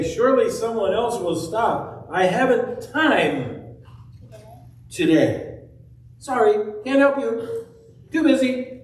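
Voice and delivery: busy Levite brushing off the wounded man; hurried Levite. Acted. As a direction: rattle off excuses while hurrying away. Levite excusing himself.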